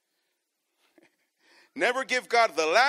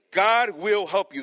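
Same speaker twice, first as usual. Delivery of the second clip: slow and louder, every word weighted; slow emphatic promise; 8 dB louder, slower than usual. Real, not acted. Preacher stresses a promise.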